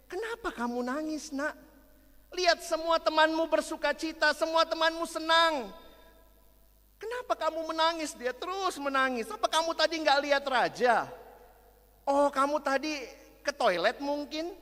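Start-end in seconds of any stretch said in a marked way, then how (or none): 0.0-8.1 high, louder, puzzled teacher; a teacher baffled by the crying girl; teacher asking the crying girl why; surprised teacher. Acted. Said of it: "gurunya kaget". 9.3-14.5 high, louder teacher coaxing an answer; teacher asking if she missed the king; questioning teacher. Acted. Teacher questioning crying girl.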